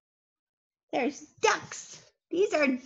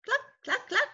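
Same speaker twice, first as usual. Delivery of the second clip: hen clucking; 7 semitones higher, faster than usual. Pretend. Chickens in review.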